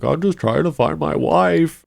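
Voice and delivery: high, whiny imitation of a sobbing man; mock crying. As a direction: plead in a mock tearful voice. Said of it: "crying and is like".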